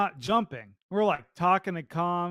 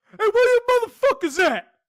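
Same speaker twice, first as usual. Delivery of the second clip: high, loud imitation of an irate teammate; squeaky angry yell; 9 dB louder than usual. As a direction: burst out shrieking at your squad. Imitates silent teammate finally yelling.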